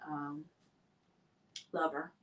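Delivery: low, soft and hesitant; tactful, gentle delivery. Shown as a demonstration. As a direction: ease carefully into delicate news. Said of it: "I would rather you just be like".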